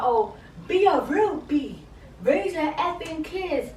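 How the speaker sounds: hammed-up heated argument voice; imitating a heated argument. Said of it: "some heated ass argument".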